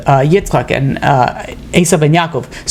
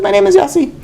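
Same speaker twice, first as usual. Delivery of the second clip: voiced much higher as the young man; young Yossi; 14 semitones higher than usual. Young man tells the rabbi his name, much higher voice. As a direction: pitch up into a boyish voice.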